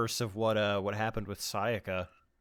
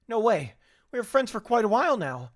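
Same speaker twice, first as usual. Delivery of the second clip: high, loud disbelieving protest; distressed protest; 10 semitones higher, 4 dB louder than usual. Acted. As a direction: refuse to believe it. Voicing upset character.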